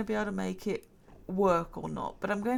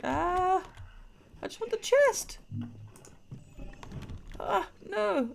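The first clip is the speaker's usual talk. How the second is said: high exasperated shouts; frustrated cries; 11 semitones higher than usual. Real, not acted. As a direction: cry out in frustration. Frustrated at full inventory.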